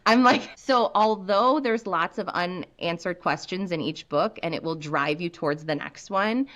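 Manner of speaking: ordinary delivery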